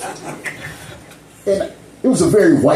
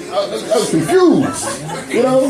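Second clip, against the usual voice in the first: ordinary talk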